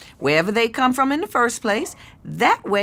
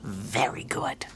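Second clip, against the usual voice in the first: whispered praise; 13 semitones lower, 9 dB quieter, slower than usual. Acted.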